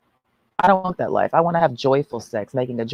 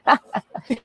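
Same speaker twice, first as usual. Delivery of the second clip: laughing giggle; 9 semitones higher than usual. Shown as a demonstration. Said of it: "I'll be riding his dick laughing".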